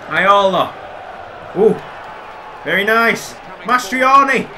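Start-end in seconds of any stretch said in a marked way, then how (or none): none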